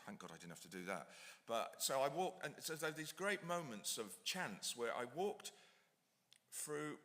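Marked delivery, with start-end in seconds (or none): 0.1-1.1 sigh, then a soft quick whisper; relieved whispered aside. Real, not acted. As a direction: breathe out and whisper it fast in relief. Relief at avoiding studio reading.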